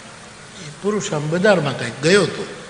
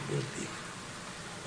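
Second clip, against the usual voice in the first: whispered warning; 21 dB quieter, much slower than usual. Retold or imitated. Husband whispers to wife not to speak, hushed.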